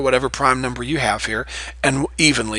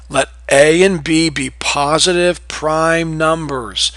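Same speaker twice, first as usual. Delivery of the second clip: slow problem reading; slower than usual. Shown as a demonstration. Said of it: "teacher reads problem slowly".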